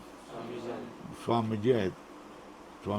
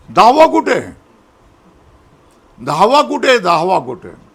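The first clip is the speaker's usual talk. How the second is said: high loud panicked दहावा कुठे; panicked cry; 5 semitones higher, 9 dB louder than usual. Retold or imitated. Boys in the tale crying out for their missing friend, panicked then fading. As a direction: call out in alarm, fading.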